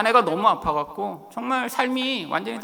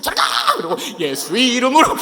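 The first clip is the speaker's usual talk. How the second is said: mock rebuke; 3 semitones higher, 5 dB louder than usual. Father rebuking the devil, laughing.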